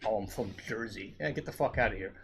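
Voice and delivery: usual speaking voice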